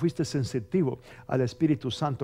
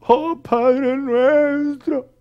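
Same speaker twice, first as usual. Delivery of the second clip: much higher, louder, very slow mock weeping; fake crying prayer; 11 semitones higher, 9 dB louder, much slower than usual. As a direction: wail it like fake tears. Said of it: "oran como que están llorando".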